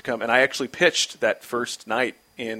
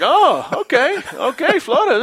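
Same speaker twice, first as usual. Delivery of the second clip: high, loud sarcastic okay; snarky; 13 semitones higher, 5 dB louder, slower than usual. Retold or imitated. Rincon answering the rookie's snarky reply, high sarcasm. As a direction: sneer it out, offended. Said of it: "it sounded kinda snarky".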